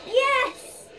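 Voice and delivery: high loud victory yell; triumphant scream. Real, not acted. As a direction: scream with sudden joy. Win in game.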